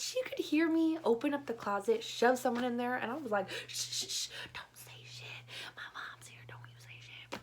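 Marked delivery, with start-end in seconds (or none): 3.7-7.4 harsh hushing whisper; urgent whisper. Retold or imitated. Girl hushing the boy in her closet, urgent whisper. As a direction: hiss it urgently so mom won't hear.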